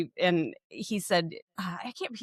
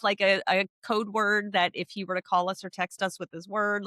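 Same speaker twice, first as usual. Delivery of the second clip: usual speaking voice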